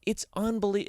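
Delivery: ordinary delivery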